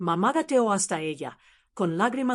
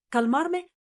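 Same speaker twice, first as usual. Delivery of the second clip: incredulous father; 8 semitones higher than usual. A narrator's reading. Enraged father mocks the plea.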